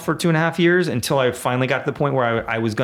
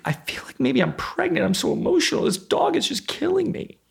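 much higher, rising into a hammed-up teary wife voice; mock emotional wife. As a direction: imitate his pregnant wife overwhelmed by the new dog. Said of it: "She said".

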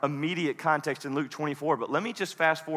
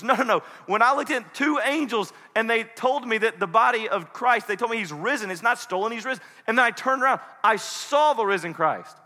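high, insistent, excited testimony; acted agitated eyewitness; freaking out; 5 semitones higher than usual. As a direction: insist on it, acting frantic with news. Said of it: "Now, she's freaking out".

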